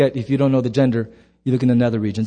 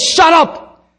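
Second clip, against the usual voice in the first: much higher, louder sharp command; commanding shout; 13 semitones higher, 7 dB louder than usual. Acted. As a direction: silence the storm with one word. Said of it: "turns to a storm and goes".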